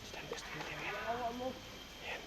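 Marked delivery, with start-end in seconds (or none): 0.0-0.8 hushed whisper while spying. Real, not acted. Watching Dango secretly, whispering to the camera. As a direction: whisper so Dango won't hear.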